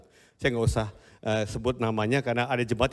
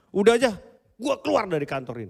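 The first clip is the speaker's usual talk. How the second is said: quick heated quit; emotional outburst; 8 semitones higher than usual. Retold or imitated. Employee quits his job in a heated moment. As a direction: blurt it out in anger. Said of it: "Kan emosi".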